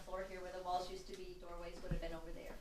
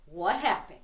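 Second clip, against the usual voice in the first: dismayed; 11 dB louder, slower than usual. Retold or imitated. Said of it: "I'm like".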